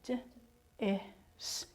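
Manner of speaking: "D-is" split sound by sound, very softly; tapped-out sounds. Shown as a demonstration. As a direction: tap out "D-is" as separate d, i, s sounds, barely voiced. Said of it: "Tap out your first syllable".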